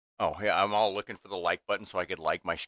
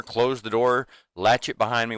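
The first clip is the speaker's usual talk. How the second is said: ordinary delivery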